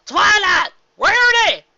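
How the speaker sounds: high, loud happy shout of names; joyful call. Acted. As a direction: call out to friends across the snow. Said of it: "Applejack called".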